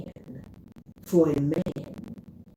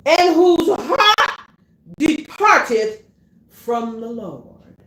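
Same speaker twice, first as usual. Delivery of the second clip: loud, high shouted preaching; preaching at full force; 10 semitones higher, 6 dB louder than usual. Acted. Preacher crying out Jeremiah to the congregation, loud and high.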